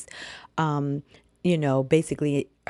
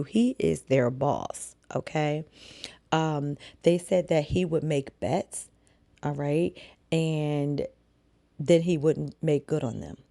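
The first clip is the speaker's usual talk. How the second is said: ordinary talk, nothing marked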